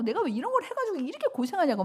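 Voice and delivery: high, fast, loud complaining wail; retold crying outburst. Preacher reliving her breakdown before the seminar, crying out high and fast. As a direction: fling the complaint out, near tears. 막 울면서.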